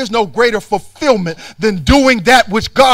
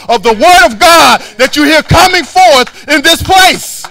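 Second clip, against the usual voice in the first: loud, rising preacher's rebuke; preaching at full force; 5 semitones higher, 9 dB louder than usual. Acted. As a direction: hammer it home from the pulpit. Sermon climbing to full voice.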